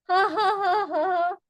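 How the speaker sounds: high staged laugh; demo laugh. Shown as a demonstration. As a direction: laugh on purpose in a run of high ha's. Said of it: "Laughter yoga".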